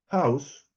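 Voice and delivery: "house" with an audible puff on the h; "house" said alone with a breathy h; aspirated English H. Shown as a demonstration. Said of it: "Y la H en inglés".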